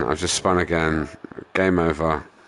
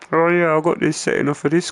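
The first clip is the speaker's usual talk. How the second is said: high, put-on mimicking voice; sarcastic high-voiced parody; mocking YouTuber imitation; 10 semitones higher, 4 dB louder, faster than usual. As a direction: mimic his boast in a silly higher voice. Mimics YouTuber, then rebuts plainly.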